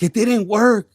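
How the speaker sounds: loud, exasperated complaint; frustrated outburst. Retold or imitated. Resentful person complaining the plan failed, loud. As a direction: blurt it out fed up.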